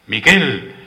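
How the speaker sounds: a short, high-rising shout of a name; exclaimed call. A narrator's reading. Juanito calls to Miguel during a break in the dance, calling out. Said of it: "exclamó".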